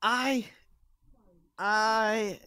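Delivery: slow, faltering, stammering start; hesitate, scared to take the laser; nervous stammer. Acted. Noodle hesitant.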